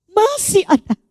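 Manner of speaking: MASIH pitched high, then falling; emphatic reassurance. Retold or imitated. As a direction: lift Masih high to insist. Reassurance repeated higher. (tekankan Masih dengan nada tinggi)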